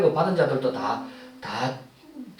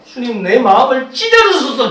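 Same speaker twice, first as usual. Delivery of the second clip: raised, high-pitched prayer; earnest pleading; 7 semitones higher, 11 dB louder than usual. Acted. Preacher models prayer to the Lord, earnest pleading. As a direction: plead loudly and fervently. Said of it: "간절히 기도하는 거예요".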